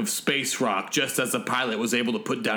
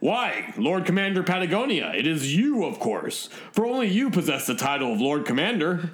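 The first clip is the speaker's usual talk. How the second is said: higher, sing-song flattery with swooping pitch; obsequious robot servant; 4 semitones higher than usual. Acted. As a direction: flatter your master in a lilting robot voice.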